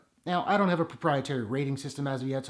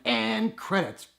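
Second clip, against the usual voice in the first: slow, high, drawn-out announcement; mock relief that the film is ending; mock credits call; 7 semitones higher, much slower than usual. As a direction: announce the ending like it's finally over. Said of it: "I'm like".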